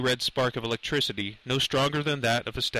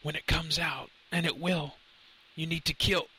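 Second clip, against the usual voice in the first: higher, hushed and short of breath; shallow breaths; 5 semitones higher, 3 dB quieter than usual. A narrator's reading. Professor fading before Lenny, faint shallow breaths. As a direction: speak faintly, as if close to passing out. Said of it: "he said with shallow breaths".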